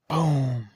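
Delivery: a big booming explosion noise; loud boom. Retold or imitated. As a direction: shout the boom like a blast. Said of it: "all you hear is".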